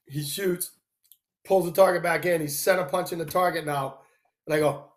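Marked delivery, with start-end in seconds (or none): none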